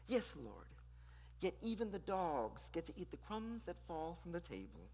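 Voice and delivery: very soft and meek, almost hushed; reply gently, as if bowing your head; meek, hushed plea to a master; soft genteel reply. Shown as a demonstration. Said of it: "she ever so softly replies".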